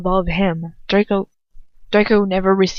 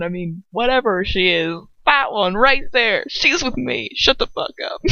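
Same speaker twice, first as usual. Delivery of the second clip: hammed-up high mock voice, laughing through it; mocking Lucius; 4 semitones higher, 5 dB louder than usual. Reader parodying Lucius defending his girlfriend, mock voice. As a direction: goof on the father's lines. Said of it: "He's like".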